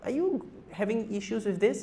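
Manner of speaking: high-pitched imagined husband's check-in; concerned husband. Acted. Doctor acts a husband checking on his wife, high-pitched role voice. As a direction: pitch it up, tentatively voicing a husband asking. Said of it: "husbands don't stop to think like".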